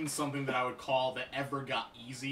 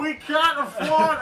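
mock cheap developer voice; 12 semitones higher, 11 dB louder, slower than usual. Mocking the budget-strapped developers.

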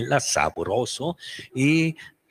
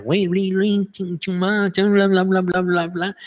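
high, louder chattering gibberish; ant language gibberish; 11 semitones higher, 4 dB louder, much slower than usual. Retold or imitated. The scientist speaks ant language, high gibberish chatter. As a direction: babble like an insect. El lenguaje de las hormiguitas.

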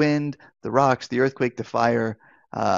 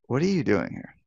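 quiet, pointed question that already knows the answer; a hint of gentle reproach; knowing rebuke; 4 dB quieter, faster than usual. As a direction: ask it like a father who knows better, softly. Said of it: "it's a question of, "You should know better than this".

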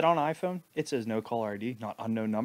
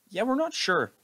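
usual speaking voice

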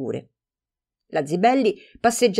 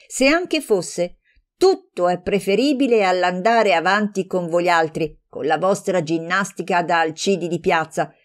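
livid tirade. Acted. Livid friend losing her temper in the argument, furious. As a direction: lose all reason and lash out. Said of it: "diventò livida e smise di ragionare".

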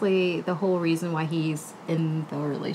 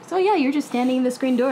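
neighbor quoted; 7 semitones higher, 4 dB louder than usual. Retold or imitated. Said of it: "the neighbor mentions".

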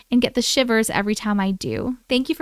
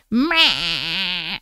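imitating ugly meow; 5 semitones lower, 3 dB louder than usual. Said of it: "she's like".

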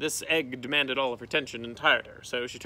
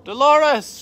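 loud, high commanding call; shouted name; 13 semitones higher, 14 dB louder than usual. A narrator's reading. Estrella calling her snake, loud commanding shout. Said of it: "she shouted".

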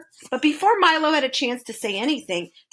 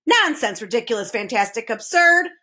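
usual speaking voice

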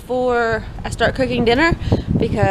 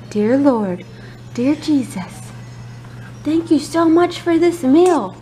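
put-on slow, high, sing-song toddler prayer; 5 semitones higher, slower than usual. Shown as a demonstration. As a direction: model a prayer for a little one.

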